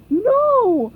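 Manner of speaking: loud, high shout of No; loud cry. A narrator's reading. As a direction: cry out in alarm. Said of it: "cried Patrick".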